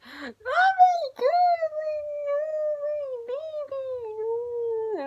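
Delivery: very high, loud hammed-up wail; verge of crying. Fan watching a boy cry to his mom, mock tearful wail. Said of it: "I'm like that on the verge of crying".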